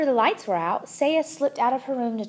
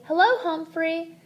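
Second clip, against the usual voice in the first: slow, higher model phrase; gentle instruction; 6 semitones higher than usual. A narrator's reading. Saya showing mom what to say, slow and gentle. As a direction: model it slowly for a learner. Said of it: "gently instructed".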